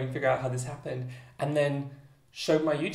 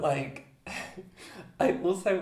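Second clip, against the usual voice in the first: ordinary talk, nothing marked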